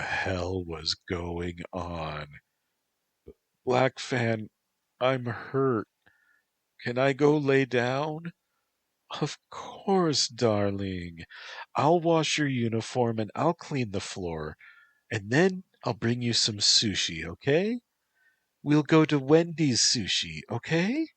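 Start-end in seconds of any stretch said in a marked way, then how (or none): 3.6-8.3 high, small pleading voice; Antropos, hurt and whimpering. Acted. 9.2-21.1 high, sing-song reassurance. Acted. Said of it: "she said, smiling".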